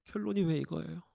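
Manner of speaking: sudden soft, dejected question; deflated complaint. Acted. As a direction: let the protest collapse into hushed despair. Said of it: "하나님을 원망하게 됩니다".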